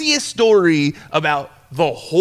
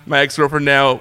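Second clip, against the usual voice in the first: ordinary talk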